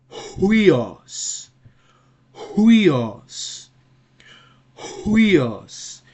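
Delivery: "huios" opened on an airy h, then high, loud, long UI; rough breathing. Shown as a demonstration. Teacher demonstrating the rough breathing, "huios" with audible h and emphatic diphthong. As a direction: breathe a clear H into "huios" and fall away. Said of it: "Pay attention to the pronunciation".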